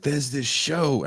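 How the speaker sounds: put-on high, drawn-out try at a Scottish accent; attempted Scottish accent. Retelling what the Scottish hosts said, half-attempted Scottish accent. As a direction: start a Scottish impression, slow and lifted. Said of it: "I'm not gonna try to do the accent".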